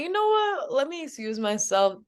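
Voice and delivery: high, drawn-up opening, then settling; lightly acted exasperated excusing of oneself; mock politeness. Worker stung by rude customer's remark, lightly mock-polite.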